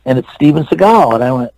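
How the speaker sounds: usual speaking voice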